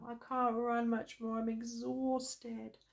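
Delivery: barely getting the words out after running; panting. A narrator's reading. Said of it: "he was panting now".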